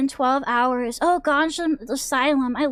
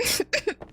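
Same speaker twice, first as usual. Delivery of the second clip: quick high-pitched giggle; high laugh; 8 semitones higher, 4 dB quieter than usual. Real, not acted. Relieved after fumbling her weapon, a high giggle. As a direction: let out a breathy little high laugh.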